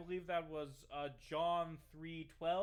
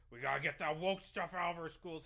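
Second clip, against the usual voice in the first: raised, louder imitation of a ranter; mock ranting; 4 semitones higher, 8 dB louder than usual. Streamer mimicking candidate on schools, loud mock rant. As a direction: push it out as a hammed-up demand.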